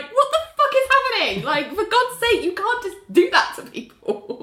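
high, incredulous mock outrage; outraged disbelief. Podcast host voicing her own shock at the scene, high incredulous yelp. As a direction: squeal it like you can't believe what you're seeing.

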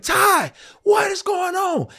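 very high amazed call to Ty; disbelief. Retold or imitated. Artist greeting fellow winner Ty in disbelief. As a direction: squeak out Ty's name in shock. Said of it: "I'm like".

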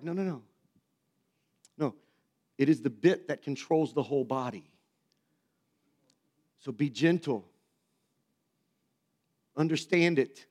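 calm him like a patient father; gentle dad. Retold or imitated. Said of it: "Dad's like".

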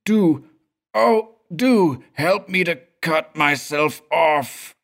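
high, slow, pleading ghostly voice; pleading spirit whisper. Acted. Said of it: "the last supplicating whisper of the invisible presence".

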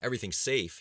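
sharp, loud retort; snapped. Acted. Governor to his aide, a sharp loud snap. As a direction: bite the words off. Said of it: "he snapped".

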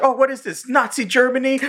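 high mock sarcasm; high sarcastic townsfolk; imitating townspeople. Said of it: "they're like".

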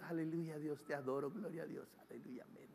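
low, very soft mock worship, fading away; half-hearted praise. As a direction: mumble it with no heart, trailing to nothing. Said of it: "No es a medias, no es".

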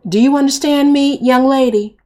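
母亲提高嗓门警告女儿; stern demand. Acted. Mother demands obedience.

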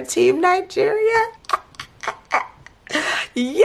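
high, bright whoop; excited cheer. Real, not acted. Vlogger cheering the winning team, high and excited. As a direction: cheer like your friend just won. Celebrating Esther's win.

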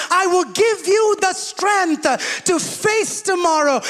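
high, loud, driving sermon climax; full-force preaching. Acted. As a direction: preach it to the rafters, slowing on the promises.

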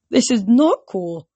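slow, high, loud objection; upset protest. A narrator's reading. Sister corrects her thrilled brother, upset. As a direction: push back in distress. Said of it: "Bianca's voice quavered".

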